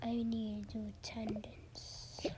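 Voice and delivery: soft whispered aside; whispering. Pretend. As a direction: whisper it to yourself.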